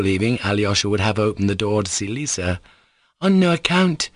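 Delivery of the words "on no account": put-on high, forceful girlish refusal; crying out. Lisa stops Alyosha opening the door, crying out. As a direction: cry out a firm refusal. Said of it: "cried Lisa".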